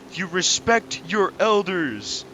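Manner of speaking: slow, lightly stern scolding; a hint of a strict elder talking down; mock stern elder. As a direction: lecture her like a grown-up, slowly. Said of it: "And I was like".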